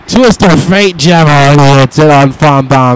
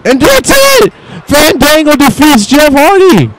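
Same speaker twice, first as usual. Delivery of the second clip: frantic call; 7 semitones higher, slower than usual. Real, not acted. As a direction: scream the climax like a ringside announcer. Match result called.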